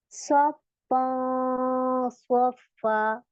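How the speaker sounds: सफा slow with a full, heavy nasal; heavy ghunna. Shown as a demonstration. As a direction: recite सफा with a thick ghunna. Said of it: "इसको कैसे पढ़ेंगे". (मोटा गुन्ना के साथ सफा)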